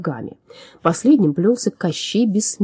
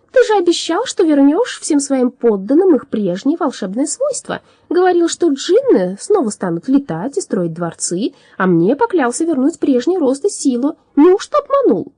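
high, loud, astonished reproach; surprised protest; 9 semitones higher, 5 dB louder than usual. A narrator's reading. Little Drom reminding the alchemist of his promise, surprised protest. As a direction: protest in raised-pitched disbelief. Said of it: "удивлённо спросил он".

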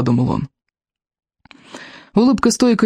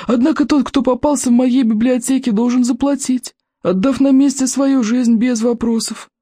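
put-on cranky old librarian; 9 semitones higher than usual. A narrator's reading. Голос библиотекаря. (сварливый старик-библиотекарь, высоко и протяжно)

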